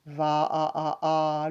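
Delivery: "va" then "a" pulsed several times, last one held; repeated vowel. Shown as a demonstration. Teacher isolating the A for pupils, repeated and held. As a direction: echo the vowel of "va" in separate beats. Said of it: "le A".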